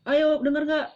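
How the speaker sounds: high, loud, fired-up pep talk; over-eager. Shown as a demonstration. Presenter overdoing it for the audience, loud and high. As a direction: whip the crowd up, too hard. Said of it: "tidak harus dengan menggebu-gebu kayak".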